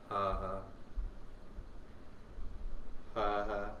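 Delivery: low double "ha" on the out-breath; breath turned to sound. Shown as a demonstration. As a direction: let the breath drop in, then release it low. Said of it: "to turn to sound".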